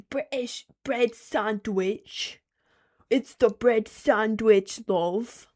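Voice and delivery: slow, hammed-up British accent; extra British accent. Shown as a demonstration. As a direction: lay the British accent on thick, drawn out. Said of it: "that's my extra British accent".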